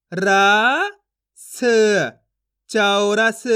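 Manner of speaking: slow chant, single syllables held long and loud; syllable reading drill. Shown as a demonstration. Teacher modelling word reading for learners, drawn out and emphatic. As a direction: stretch each sound apart, then blend it evenly. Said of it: "आपको मेरे तरीके पे गौर करना है".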